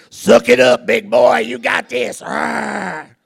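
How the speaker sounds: loud gruff cheer-on; coach shout. Retold or imitated. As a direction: bark it like friends urging a patient on. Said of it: "standing over me going".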